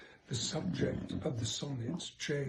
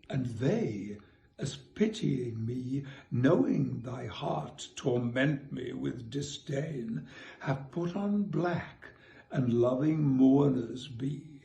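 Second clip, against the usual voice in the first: measured poetry reading, louder; reciting a sonnet; 8 dB louder, slower than usual. Acted. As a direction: read the sonnet with care. Reads sonnet aloud.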